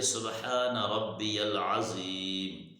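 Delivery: slow, drawn-out tasbih; slow recitation. Shown as a demonstration. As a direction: recite it slowly, word by word. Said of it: "کیا پڑھنا ہے؟". (آہستہ تلاوت)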